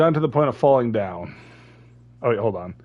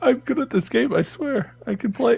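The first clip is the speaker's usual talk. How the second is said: high-pitched protest; 10 semitones higher than usual. Real, not acted. Defends himself after failing.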